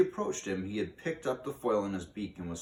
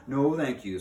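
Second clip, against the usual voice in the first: firm refusal; 6 semitones higher, 8 dB louder than usual. Acted. Mouse firmly declines the crow.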